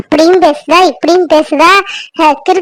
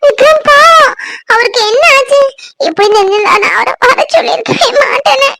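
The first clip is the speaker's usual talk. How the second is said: crying; 5 semitones higher than usual. Acted. Woman grieving over injured Vikram, calling her brother, crying. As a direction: wail in distress for your loved one. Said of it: "ஏன் அழுதுட்டு இருக்க?".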